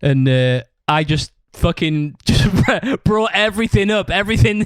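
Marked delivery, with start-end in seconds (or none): 2.2-4.6 laughing. Real, not acted. Laughs recalling the blow-up.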